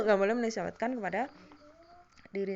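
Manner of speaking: speaking right after a cough; coughing fit. Real, not acted. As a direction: cough then rushed words. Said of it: "maaf, ini batuk".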